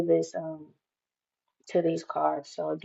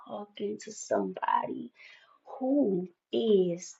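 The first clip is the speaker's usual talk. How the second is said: whispered, overcome; real emotional whisper; hushed whisper; 7 dB quieter than usual. Real, not acted. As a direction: whisper as if moved to tears. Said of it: "I could fucking cry".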